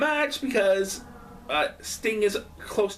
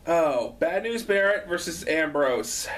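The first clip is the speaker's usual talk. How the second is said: ordinary talk, nothing marked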